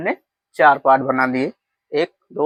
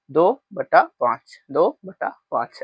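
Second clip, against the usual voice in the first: slow model reading; slower than usual. Shown as a demonstration. Teacher showing the class how to read the fraction, slowly. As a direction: read दो बटा पाँच slowly, word by word, like a teacher. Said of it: "ऐसे पढ़ा जाता है".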